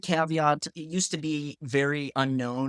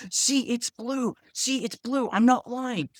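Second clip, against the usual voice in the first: high-pitched, drawn-out acted insistence; high mock-frantic vindication; insistent proof-showing; 10 semitones higher, slower than usual. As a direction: imitate a boy pleading to be believed, pitched way up. Boy shows everyone his proof.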